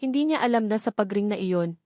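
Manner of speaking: usual speaking voice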